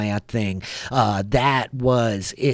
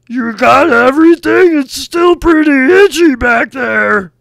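yelling; 17 dB louder than usual. Acted. Gump calls from the back.

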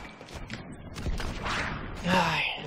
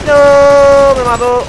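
loud, high cry of defeat; anguished shout; 8 semitones higher, 11 dB louder, much slower than usual. Real, not acted. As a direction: shout it as you die. Killed in game. (grito de derrota fuerte)

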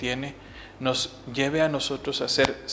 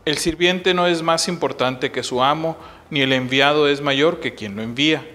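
louder, solemn proclamation of scripture; Gospel reading; 2 semitones higher, 5 dB louder than usual. Acted. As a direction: proclaim the Gospel with raised, projected voice. Reads the Gospel aloud at Mass.

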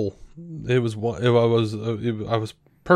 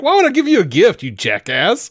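high, loud and fast sneer; hammed-up scornful brother, much higher and louder; mocking Cain voice; 9 semitones higher, 5 dB louder than usual. Cain scoffing at Abel over the gift, high loud mocking voice. Cain's reply redone as mock.

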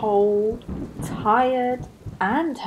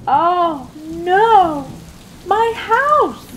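much higher, louder despairing cry; yelling Beaver; 10 semitones higher, 6 dB louder, much slower than usual. Acted. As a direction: wail as his house falls. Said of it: "yelled Beaver".

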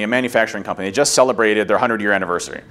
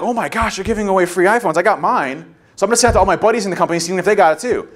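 excited coworker; 6 semitones higher than usual. Retold or imitated. Coworkers hyped about free iPhones.

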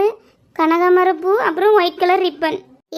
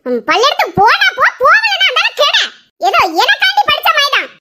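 angry scolding; 8 semitones higher than usual. Acted. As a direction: lash out at the child, voice pitched high. Mother scolds crying child.

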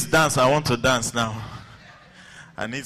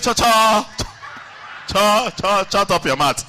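angry stuttered scolding; stammering anger; 6 semitones higher, 3 dB louder than usual. Retold or imitated. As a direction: snap at the class while stammering. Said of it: "he's a conch stammerer".

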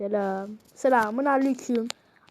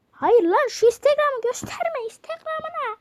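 scolding shout; 11 semitones higher than usual. Real, not acted. Shouting at the friend to hide Instagram. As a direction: yell at him to stop showing it.

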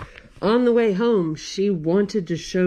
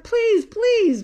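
imitating Rose's pleading please, high and loud; a daughter pleading with her mom, mock begging; 13 semitones higher, 4 dB louder than usual. Child begging to read book.

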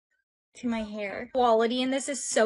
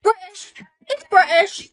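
put-on loud, high British accent; 7 semitones higher, 8 dB louder than usual. Student claiming a British soulmate, loud put-on British accent. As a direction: insist loudly in a sudden British accent. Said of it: "speaking in a British accent".

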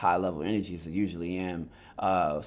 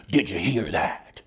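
slow, louder hammed-up Showbiz voice; imitating Showbiz; 5 dB louder, slower than usual. Mocking the YouTuber for his viewers, slow and loud. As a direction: drag it out like a breathless pundit. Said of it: "Showbiz does what he normally does".

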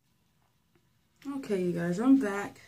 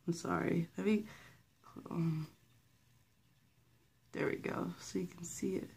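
hushed; 12 dB quieter than usual. Real, not acted. Hushed, camera close, unvoiced.

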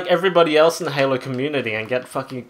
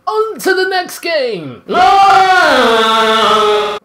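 excited shout; 10 semitones higher, 10 dB louder, much slower than usual. Real, not acted. Announcing the next round.

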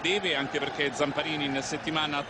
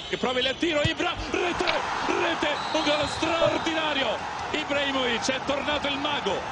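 goal call; 12 semitones higher than usual. Real, not acted.

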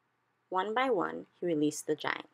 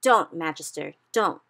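louder urgent warning; urgent cry; 8 dB louder than usual. A narrator's reading. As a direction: stop her before she bites. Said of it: "cried the B F G".

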